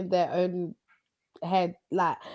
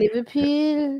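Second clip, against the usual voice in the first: attempted Scouse; 3 semitones higher, slower than usual. Shown as a demonstration. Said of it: "Can you say again".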